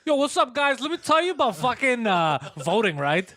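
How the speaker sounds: high, loud hyped-up street voice; mock hype guy. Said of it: "you play a version of that".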